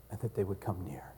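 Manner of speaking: soft whisper; whispered prayer. Real, not acted. Pastor praying for hearts, hushed whisper. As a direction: breathe the prayer's words.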